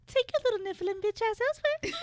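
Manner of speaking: high, fast sneering witch; snooty voice. Acted. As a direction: spit it out like a snooty villainess.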